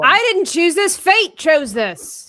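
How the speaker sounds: loud, high-pitched mock outrage; mock protest. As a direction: shout it in playful self-defense, stretching the end. Mock quarrel over gingerbread theme.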